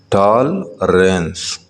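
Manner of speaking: ٹال رینس slowly split in two; teacher sounding out ٹال رینس in separate parts; syllable by syllable. Shown as a demonstration. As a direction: stretch ٹال, pause, then رینس. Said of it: "Read the following multi-syllable words".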